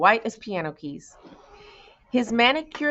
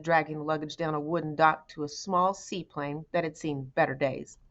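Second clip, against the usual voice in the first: ordinary delivery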